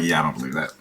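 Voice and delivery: low, soft, unconvinced mumble; sagging, doubtful aside; unconfident mimicry. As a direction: imitate someone with no belief in the pitch. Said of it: "he just doesn't have the confidence".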